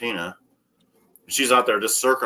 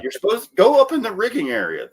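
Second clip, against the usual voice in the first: retold scolding; 11 semitones higher, 5 dB louder than usual. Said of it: "I'm like".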